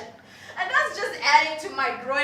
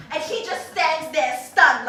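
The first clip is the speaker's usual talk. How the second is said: normal talking voice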